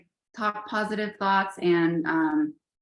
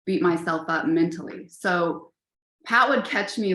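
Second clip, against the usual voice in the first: ordinary talk, nothing marked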